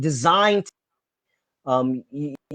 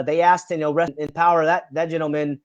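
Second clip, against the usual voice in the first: ordinary talk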